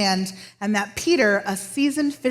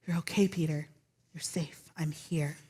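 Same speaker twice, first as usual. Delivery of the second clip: soft, hushed comfort; tender reassurance; 5 semitones lower, 10 dB quieter than usual. Acted. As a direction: whisper comfort to a frightened friend. Jesus comforting sinking Peter.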